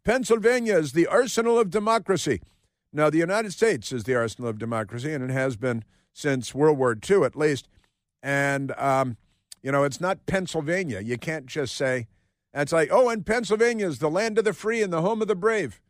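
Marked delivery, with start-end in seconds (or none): 0.0-2.4 high-pitched mock politician with put-on accent; Josh Shapiro. Host mocking the governor's speech, put-on orator's accent. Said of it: "Why did he speak with that accent?". 12.9-15.8 much higher, hammed-up patriotic declaiming; mock grand orator. Host mocking the governor's boasts, hammed-up oratory.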